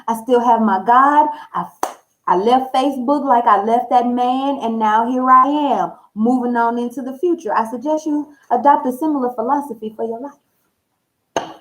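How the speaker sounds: ordinary talk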